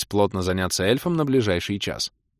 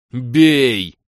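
loud, raised battle cry; yelled order; 4 semitones higher, 7 dB louder than usual. A narrator's reading. Hero rallies the party to attack, yelling. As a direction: holler the command to strike. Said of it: "завопил я".

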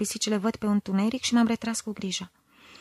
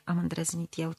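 plain reading voice

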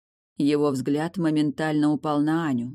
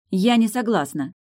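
higher, louder firm refusal; objecting; 6 semitones higher, 5 dB louder than usual. A narrator's reading. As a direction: object with a touch of defiance. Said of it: "снова возразила Аня".

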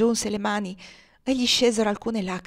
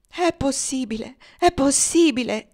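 high cry of disbelief, growing louder; anguished exclamation; 6 semitones higher, 5 dB louder than usual. A narrator's reading. Young man finding his dying friend, exclaiming in disbelief. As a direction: exclaim it twice, the second time harder. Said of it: "esclamò inginocchiandosi presso di lui".

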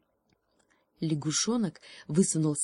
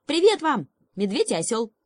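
high, loud hail to the animals; cheery greeting; 6 semitones higher, 5 dB louder than usual. Acted. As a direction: call out a bright hello. Frog hails bear and donkey.

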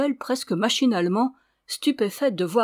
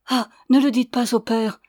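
urgent plea, louder; pleading; 3 semitones higher, 6 dB louder than usual. A narrator's reading. Ramona begs the señora not to tell the priest, pleading. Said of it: "d'un ton suppliant".

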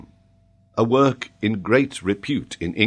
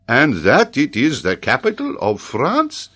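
incredulous professor; 7 semitones higher, 5 dB louder than usual. Acted. Paganel presses on, incredulous.

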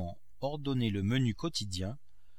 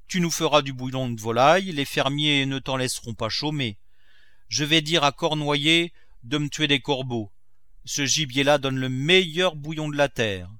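louder, brisk master's orders; lightly voiced miser Grandet; 4 semitones higher, 8 dB louder than usual. Miser ordering crow broth from his cook, mildly emphatic. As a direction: lay down the menu firmly.

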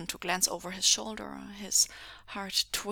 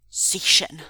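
loud sharp call; barked name; 11 dB louder than usual. A narrator's reading. Captor barks a name across the room. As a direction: bark the name out. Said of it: "Mingzhu barked".

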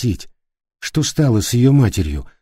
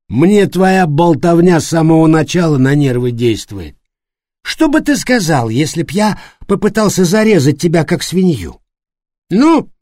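put-on loud drunken threat, higher; drunk angry; 7 semitones higher, 4 dB louder than usual. A narrator's reading. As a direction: snarl drunkenly. Пьяный Флориан кричит на Давида. (пьяная злость)

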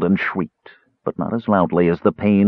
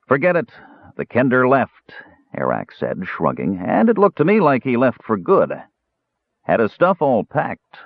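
higher, blunt dwarf slaver, shrugging it off; dwarf Arak's voice; 6 semitones higher than usual. Acted. Slaver dwarf tells Caramon the kender left, offhand dwarf voice. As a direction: play the dwarf, raised and offhand.

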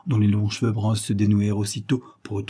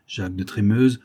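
ordinary delivery

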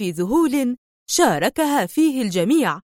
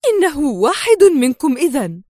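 louder, higher realisation; exclaiming; 5 semitones higher, 4 dB louder than usual. A narrator's reading. Sonia exclaiming to the three men. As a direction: call it out as it dawns on you. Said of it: "وهتفت".

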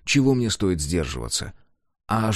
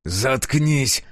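growling; 5 semitones higher than usual. A narrator's reading. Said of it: "прорычал я".

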